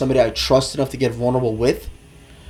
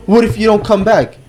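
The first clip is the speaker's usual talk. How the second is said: mock girlfriend; 7 semitones higher, 3 dB louder than usual. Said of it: "girlfriend voiced in fight".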